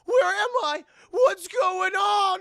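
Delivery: high, loud, wailing shout; hammed-up terrified wail; waking panic. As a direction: cry out in slow, drawn-out confusion. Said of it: "snaps out of his trance".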